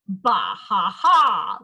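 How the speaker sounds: mocking storm laugh; tornado laughing at Holler; twister laughing. A narrator's reading. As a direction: laugh down at the little boy. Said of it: "laughed the twister".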